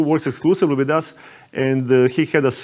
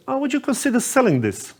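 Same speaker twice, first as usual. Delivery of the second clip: lightly voiced naive rich tourist, higher; a hint of a wide-eyed American lady, pitched up; wealthy lady; 11 semitones higher than usual. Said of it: "And the lady goes".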